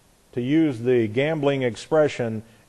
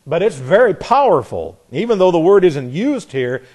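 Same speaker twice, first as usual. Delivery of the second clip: ordinary talk, nothing marked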